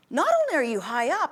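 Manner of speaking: normal talking voice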